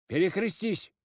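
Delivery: sharp, high, loud order; the head's command. A narrator's reading. Village head ordering at the door, loud high command. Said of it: "сказал голова".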